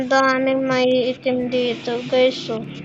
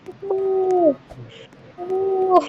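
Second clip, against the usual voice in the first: frantic; 6 semitones higher than usual. Real, not acted. Fleeing, screaming to run.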